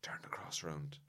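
urgent whisper from the pews. Retold or imitated. Man in the congregation whispering to the altar boy, unvoiced. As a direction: hiss it under your breath in church. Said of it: "he was like".

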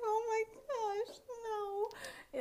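high, whimpering mock sob; hammed-up tearful dismay; dramatic fake crying. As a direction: pretend to be about to cry. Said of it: "I was just dramatically fake crying".

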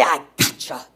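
low, soft gloat from the devil; a hint of the devil, dropped low and quiet; lightly voiced devil. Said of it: "then he'll say".

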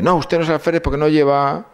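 voz aguda y tensa de recluta; soldado nervioso. Retold or imitated. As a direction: imitando a un centinela que desconfía. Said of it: "Soy el alférez". (el recluta niega que sea el alférez, tenso)

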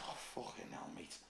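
breathy, very soft groan of shame; embarrassed whisper. Retold or imitated. Laughed at by the bar, muttered in whisper. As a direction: whisper it, mortified. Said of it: "And I went".

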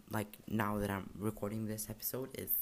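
ordinary talk

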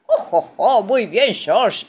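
jolly, loud ho-ho of praise; hearty laughing congratulations; congratulating. A narrator's reading. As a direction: chuckle proudly, then praise the child. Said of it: "lo felicita Papá Pig".

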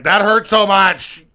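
loud, drawn-out complaint, pitched high; mock pained cry. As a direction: wail it slowly, hamming up the hurt. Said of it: "I'm like".

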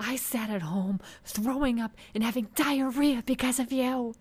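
high, loud dramatic complaint; hammed-up accusing wail; mock outrage. Acted outburst quoted for laughs.

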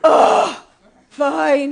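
loud grudging groan then a sulky give-in. Shown as a demonstration. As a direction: huff like a resentful child. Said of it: "there's normally, like, a".

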